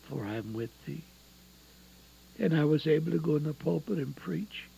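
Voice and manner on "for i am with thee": little voice. Retold or imitated. Preacher voicing what he heard during prayer, little voice. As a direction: drop to a small, soft voice. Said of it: "I heard a little voice".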